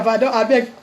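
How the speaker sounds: normal talking voice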